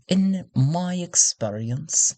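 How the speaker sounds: drawn-out, deliberate courtier's catchphrase; alluring courtier. Shown as a demonstration. Game master trying her signature phrase, slow courtier voice. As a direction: stretch it slowly and alluringly. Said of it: "a phrase that she would often say".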